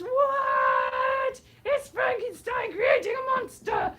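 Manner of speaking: high-pitched, drawn-out shout of disbelief; hammed-up crowd reaction, shrieked slowly; mock astonishment. Acts out everyone's astonished reaction.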